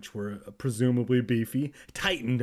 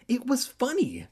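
high-pitched acted exclamation; exclaiming; 12 semitones higher than usual. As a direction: insist brightly in a high voice. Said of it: "Andy exclaimed".

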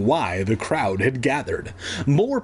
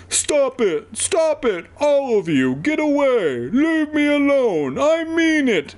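high-pitched hammed-up yelling; screaming outburst; 13 semitones higher, 3 dB louder than usual. A narrator's reading. Evan screaming at police to leave, high frantic yell. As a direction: cry out each command, very high.

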